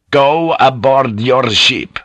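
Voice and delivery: slow, weighty repeated order; insistent command. Acted. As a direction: press each word of the order. Repeated command after officer refuses.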